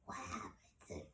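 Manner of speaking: barely audible, voiceless whisper; imitated feeble patient whisper; weak voice. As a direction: whisper it faintly, as if the voice is gone. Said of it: "that's how I sounded".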